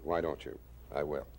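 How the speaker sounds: normal talking voice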